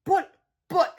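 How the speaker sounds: high, halting protest; stammering. A narrator's reading. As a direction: stammer out an objection. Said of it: "Harold stammered".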